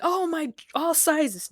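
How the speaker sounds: high, giddy fake excitement; mock excited. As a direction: gush like you won big. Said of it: "don't get excited like".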